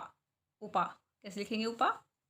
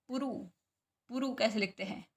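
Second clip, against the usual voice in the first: usual speaking voice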